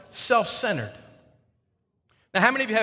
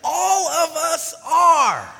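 high, loud teasing call-out; emphatic preaching; 9 semitones higher, 3 dB louder than usual. Real, not acted. Pastor teases congregation for denying self-centeredness, loud and high. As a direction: call them out with a laugh in the voice.

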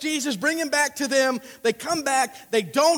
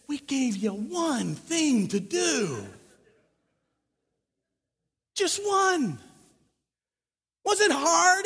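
drawn-out, higher, scolding disbelief; exasperated translation; 5 semitones higher, much slower than usual. Acted. Chief priests to temple guards who came back empty-handed, exasperated. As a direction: spell it out slowly for a failed crew. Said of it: "the translation is this".

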